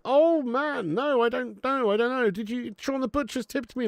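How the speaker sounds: high, excited disbelief; thrilled fan reacting to a surprise tip, high and excited. Real, not acted. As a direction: gush over the surprise tip, pitch way up.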